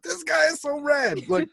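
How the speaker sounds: high-pitched hammed-up fangirl goddess; Athena gushing. Athena watching Odysseus defy Poseidon, gushing. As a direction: squeal it in mock admiration.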